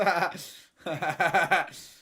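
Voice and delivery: loud, drawn-out cackle; gloating laugh. Real, not acted. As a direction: burst out laughing at the rival. Gloating at rival's disallowed goal.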